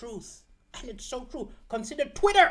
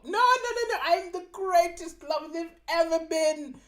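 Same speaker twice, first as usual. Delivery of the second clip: indignant denial; 9 semitones higher than usual. Acted. Boyfriend answering his upset girlfriend, high indignant protest. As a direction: scoff at the accusation, pitched way up.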